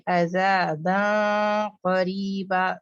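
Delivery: عذاباً قریبا recited very slowly in a chanting voice with a heavy ikhfa; tajweed recitation. Shown as a demonstration. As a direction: chant عذاباً قریبا slowly, the tanween hidden heavily before قاف. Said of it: "اور پھر یہاں پہ دیکھیے". (عذاباً قریبا کو آہستہ، بھاری اخفا کے ساتھ تلاوت کریں)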